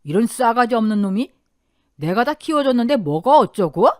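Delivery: the uncle loses his temper; 조카에게 화를 참지 못한 큰아버지, 분노; furious outburst. A narrator's reading. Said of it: "화를 참지 못하고".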